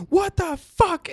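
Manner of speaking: high shrieky impression of the angry wife; mock angry wife. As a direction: screech it like the raging wife. Wife storms in cussing.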